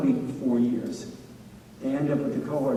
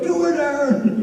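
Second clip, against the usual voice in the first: mock party cheer, much higher; egging shout; 12 semitones higher, 4 dB louder than usual. Friends at a party daring a teen, mock cheering shout. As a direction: yell it like a friend daring him.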